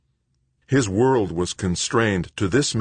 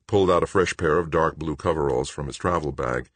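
ordinary delivery

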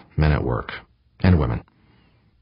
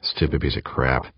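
ordinary delivery, nothing marked

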